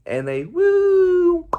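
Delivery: long high loud woo, slow; drawn-out whoop. Acted. Trids sail over the mountain, long high whoop.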